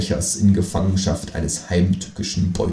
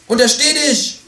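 very high, loud kangaroo shout; 4 dB louder than usual. Acted. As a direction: shout a warning like an outraged kangaroo. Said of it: "rief das Känguru".

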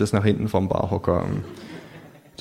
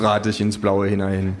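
usual speaking voice

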